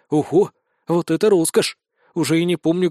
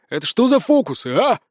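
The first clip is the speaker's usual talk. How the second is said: angry sheriff; 5 semitones higher than usual. A narrator's reading. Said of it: "Краснея от ярости, прорычал шериф".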